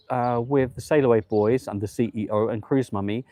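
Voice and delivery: ordinary delivery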